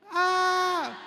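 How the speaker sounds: high long scream of someone falling. Shown as a demonstration. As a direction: scream as you drop off the cliff. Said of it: "Sigaw kayo". (sigaw ng nahuhulog)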